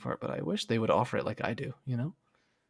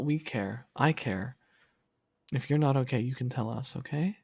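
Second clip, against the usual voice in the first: normal talking voice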